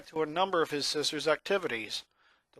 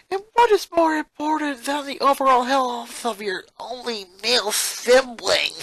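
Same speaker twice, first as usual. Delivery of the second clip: higher, louder mocking question; sarcastic boy; 10 semitones higher, 7 dB louder than usual. Lincoln mocks his sister's wording, sarcastic and loud. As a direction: throw her words back at her. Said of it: "imitated the last part in her voice".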